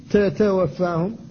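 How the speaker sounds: تتوفاهم said very slowly, every ta held tight; slow careful ta. Shown as a demonstration. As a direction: spell out تتوفاهم slowly, each ta firm. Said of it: "فلا بد من الحفاظ على شدتها". (تتوفاهم ببطء مع الحفاظ على شدة التاء)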